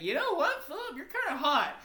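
high-pitched tipsy come-on. Acted. Wasted soldier hitting on his best friend, high and playful. Wasted Larry flirts with Philip.

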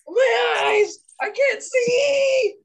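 high pained shouted wail, slow on the last words; mock screaming in pain. Retelling a friend's gouged victim, screaming in pain. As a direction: wail as if blinded. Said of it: "all you hear is".

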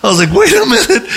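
high, urgent mock-panicked "wait"; frantic plea. Missionary stopping the guards from shooting, frantic plea. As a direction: plead for everyone to hold on, pitch climbing. Retelling his urgent halt to others.

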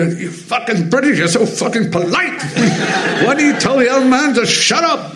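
high put-on old man voice, laughing through it; Walter Matthau impression. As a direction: tease the polite Brit in a cranky old voice. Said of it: "He'd go".

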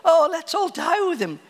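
sarcastic pessimist. Retold or imitated. Thomas mocking the trip back to Bethany, sarcastic. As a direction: play the gloomy skeptic, dripping sarcasm. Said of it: "You can hear the sarcasm and the cynicism in his voice".

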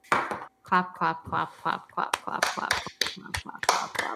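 soft breathy "clap clap" chant; whispered clapping. Pretend. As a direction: mouth the clapping under your breath. Applause after the show segment.

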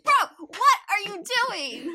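loud, high scolding of a fictional guy; high, punchy fan outrage; exasperated. Acted. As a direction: call him out in comic disbelief.

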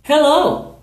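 high model Hello; demo greeting. Shown as a demonstration. Teacher modelling hello for students, high. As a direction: model it cheerfully for learners. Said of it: "is a greeting".

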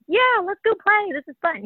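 put-on high, bright little-kid voice; chirpy toddler voice. Mom voicing her daughter, high playful kid voice. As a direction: play the happy sick baby. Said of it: "she'd be like".

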